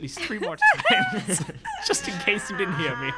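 high giggly laughing aside. Real, not acted. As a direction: crack up mid sentence. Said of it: "Let me say assist".

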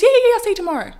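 high, loud, breezy brush-off; airy fake-cheerful art director voice; fake-sweet director. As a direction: chirp it carelessly. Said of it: "she goes".

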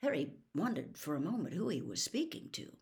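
plain reading voice

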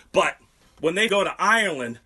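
normal talking voice

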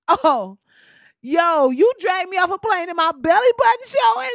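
loud, high, outraged; outraged shout. Pretend. Playing the dragged passenger, shouting in outrage. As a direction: yell it like you can't believe it.

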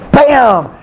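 sudden exclamation. Acted. Resurrection moment, exclaimed.

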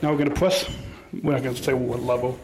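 usual speaking voice

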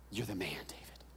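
soft whispered accusation. Acted. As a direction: breathe the verdict quietly. Quiet final accusation.

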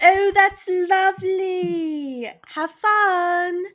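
high, slow, sugary lady voice; breathy vintage purr. Retold or imitated. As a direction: coo it like a doting old lady. Mimicking the cat lady interrupting.

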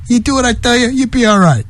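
lightly voiced mob boss making an offer; mobster terms. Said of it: "mobsters, they go".

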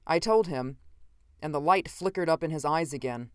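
ordinary delivery

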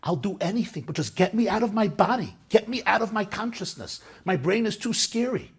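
loud, high, anguished pleading; desperate plea. Retold or imitated. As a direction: cry out like someone who wants to escape their own mind. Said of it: "They'll say".

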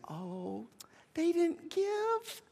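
tiny high voice, slow and fretful; badly faked helpless worrier, very high and soft; mock distress, drawn out in a feeble squeak; hand-wringing whimper. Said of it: "God's not up there wringing his hands".

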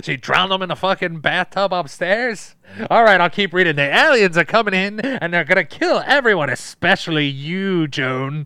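loud, high mock-dramatic broadcast; hammed-up radio reader. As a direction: belt out the alien alarm with comic venom. Hammed-up radio reader of alien invasion.

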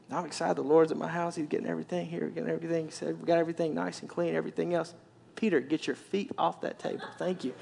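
soft, low muttering while busy; hushed busy fussing; Martha fussing under her breath. Acted. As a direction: grumble it quietly to herself. Martha muttering while she cooks.

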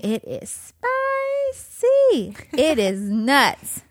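squealed high then slow drawn-out; dramatic gossip. Acted. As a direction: savor the juicy gossip. Gossiping about Below Deck.

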